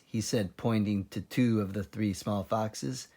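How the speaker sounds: ordinary delivery, nothing marked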